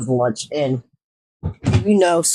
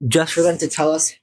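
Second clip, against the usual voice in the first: normal talking voice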